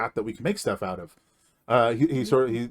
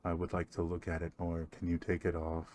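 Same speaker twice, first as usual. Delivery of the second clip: low, soft, intimate murmur; creepy quiet voice; 5 semitones lower, 13 dB quieter than usual. Acted. Strange elf admires the player's cloak in a low, soft voice. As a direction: creep closer with a hushed voice.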